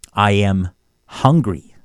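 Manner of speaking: normal talking voice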